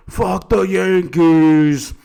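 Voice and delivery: loud, slow heckling shout; obnoxious fan yell. Retold or imitated. Boston fan yelling at the Yankees in the stands. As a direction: bellow it like a rowdy Boston fan. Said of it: "like your typical Boston fucking fan".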